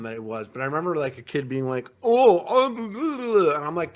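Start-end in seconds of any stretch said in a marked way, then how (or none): 2.0-3.5 mocking kid noises. Mimicking a kid's silly noises.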